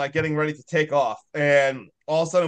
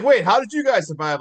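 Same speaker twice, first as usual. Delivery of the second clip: higher, louder surprised quote; surprised question; 5 semitones higher, 4 dB louder than usual. Retold or imitated. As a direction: ask it amazed. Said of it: "they're like".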